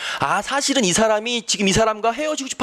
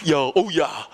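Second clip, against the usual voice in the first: restrained insincere admiration, slightly lower; understated fake compliment; 3 semitones lower than usual. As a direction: praise a friend's hair without overdoing it. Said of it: "너무 오버하면 안 된다". (오버하지 않는 가짜 감탄)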